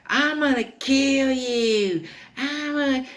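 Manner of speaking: very high, slow, squealed threat; hammed-up toddler wail at the top of the range; crying angry kid. Toddler won't back down from babysitter, squealed mock threat. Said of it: "And he's crying".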